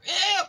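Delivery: high, loud squeal of disgust. Acted. Reading to a child, milk all over the piglet, squealing.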